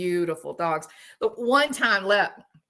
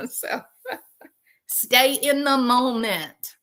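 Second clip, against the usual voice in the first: slow words with a laugh through them; real laugh breaking into the line; 5 semitones higher, slower than usual. Real, not acted. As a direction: giggle your way through the advice.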